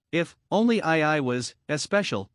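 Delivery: ordinary delivery, nothing marked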